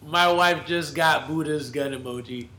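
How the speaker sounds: normal talking voice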